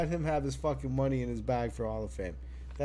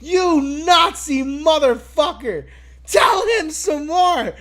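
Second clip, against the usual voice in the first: high, loud, gleeful shouting; excited yelling; 12 semitones higher, 13 dB louder than usual. Retold or imitated. Podcaster retelling his own reaction to Gunther, gleeful yelling. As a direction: cheer him on like a fan losing it. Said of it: "I was just like".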